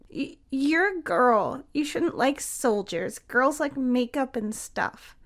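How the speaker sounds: put-on a hurt child lecturing; little boy voice. A narrator's reading. Jamie telling Tia girls like makeup, slow and small.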